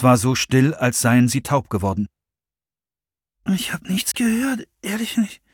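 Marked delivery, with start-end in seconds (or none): 3.5-5.4 hohes, leises Flüstern; geflüstert. Acted. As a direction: beruhigend ins Dunkle flüstern. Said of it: "meinte Madonna". (Madonna flüstert der Freundin zu)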